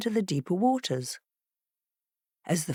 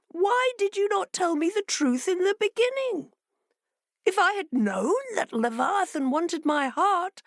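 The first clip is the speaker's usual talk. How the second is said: much higher, sly aggrieved fox; fox reproaching; 12 semitones higher than usual. A narrator's reading. Fox tricking the fishes back, mildly aggrieved. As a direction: sound wronged while scheming.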